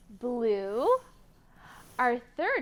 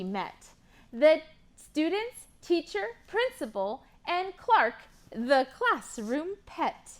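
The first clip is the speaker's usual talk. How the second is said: rhyming picture book read with lively lilt; reading to children; slower than usual. Acted. Teacher reading a rhyming book to kids, lively. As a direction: read the rhymes to a class of kids.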